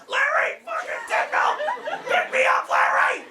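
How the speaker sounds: Jeff Garlin style yelling into the phone; mock phone scream. Freaking out and yelling down the phone at his friend Larry, frantic mock scream. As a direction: scream it down the phone, pitch jumping wildly. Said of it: "screaming in my phone".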